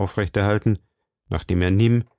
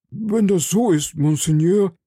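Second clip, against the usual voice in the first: higher, eager timid tradesman; frightened shopkeeper pleading with the cardinal; nervous shopkeeper; 7 semitones higher than usual. A narrator's reading. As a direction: play the anxious little shopkeeper.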